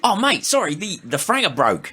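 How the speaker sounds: high mock Australian accent. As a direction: apologize in a hammed-up Aussie accent. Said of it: "everyone's best Australian accent".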